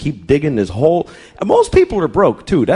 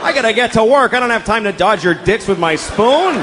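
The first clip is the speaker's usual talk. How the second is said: exasperated yell; 6 semitones higher than usual. Retold or imitated. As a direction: shout at them to clear out of the kitchen. Man at breakfast yelling at intruders.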